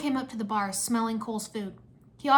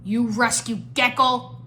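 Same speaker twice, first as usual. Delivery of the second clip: loud, slow monster outburst; indignant exclamation; 6 dB louder, slower than usual. A narrator's reading. Mert bumped by a fleeing gecko, indignant exclamation. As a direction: exclaim it heavily, word by word. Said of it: "Mert exclaimed".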